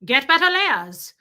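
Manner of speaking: slow, loud, high flat order; blunt [name]. Shown as a demonstration. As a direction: pull no punches, slow and loud. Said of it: "pull no punches".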